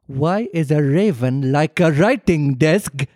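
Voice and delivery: slow, put-on lemur king voice; stuck-up, slightly mad royal voice; King Julian. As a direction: ask the riddle like a pompous cartoon lemur king. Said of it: "Can you be King Julian from Madagascar?".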